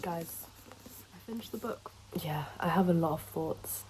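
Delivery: hushed whisper. Real, not acted. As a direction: whisper it quietly. Back after finishing book, whispering.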